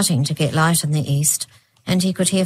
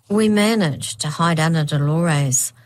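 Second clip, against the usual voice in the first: ordinary delivery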